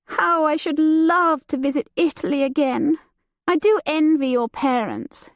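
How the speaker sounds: light and teasing, a society lady; Judith, lively young lady. Acted. Judith teasing her cousin about the new servant, playful and high. Judith voiced beside narration.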